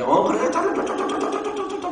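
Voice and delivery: comic impression of a one-sided phone call; nonstop phone chatter. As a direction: imitate someone rattling on without a breath. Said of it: "자기 혼자 말다 하고 또 끊어".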